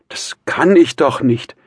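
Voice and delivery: high, loud, slow protest; hurt cry. A narrator's reading. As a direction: cry out, offended. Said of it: "rief das Mädchen gekränkt".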